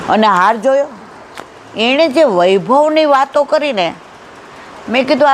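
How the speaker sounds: normal talking voice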